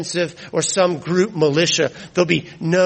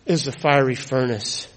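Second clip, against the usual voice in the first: usual speaking voice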